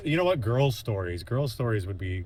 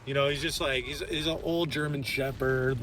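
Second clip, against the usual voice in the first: mimicking her; 5 semitones higher than usual. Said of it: "And she's like".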